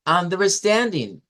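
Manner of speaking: Understanding slowed, stand on top; stress on stand. Shown as a demonstration. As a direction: rise to stand then fall. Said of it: "la sílaba tónica".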